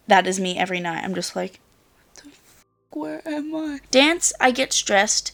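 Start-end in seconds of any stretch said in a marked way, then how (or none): 2.1-3.0 barely audible whisper; hushed, voiceless disbelief; whispered confusion. Acted. Acting out confused waking. 3.0-3.8 high, soft bewildered voice; small high mock-disoriented question; acted waking confusion.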